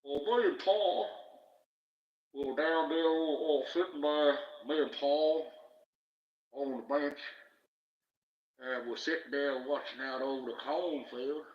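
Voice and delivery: loud, high Southern US drawl; hammed-up Southern storyteller, loud and drawn out; Southern US accent. Shown as a demonstration. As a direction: put on a slow, big Southern US twang. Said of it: "Can you do Southern U S accent?".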